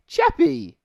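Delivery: high, loud cry of the name; playful shout. Acted. Co-host savoring a favorite robot's name, playful high shout. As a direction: sing out the name with a big high burst. Gleefully calls out beloved character name.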